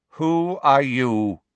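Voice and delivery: lightly voiced stern centaur; challenging stranger. A narrator's reading. Unknown centaur challenges the intruders.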